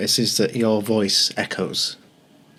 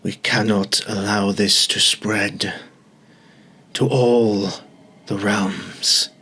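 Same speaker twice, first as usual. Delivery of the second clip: booming voice; slower than usual. Acted. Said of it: "a booming voice".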